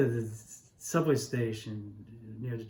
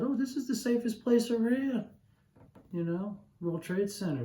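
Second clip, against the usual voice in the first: higher, mock-confident inner voice; lightly ironic high reassurance; naive self-assurance; 9 semitones higher than usual. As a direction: imitate your past self's cheery certainty. Retold own naive thought.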